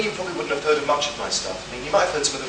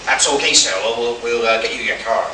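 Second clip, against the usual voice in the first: lightly attempted American accent, a bit louder at first; bad American accent; 5 dB louder than usual. Hotel staff promising a visitor a car, in a poor American accent. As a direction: have a rough go at American. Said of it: "that's, that's my American accent".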